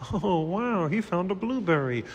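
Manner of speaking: cooing delight. Acted. Improv, cooing over baby.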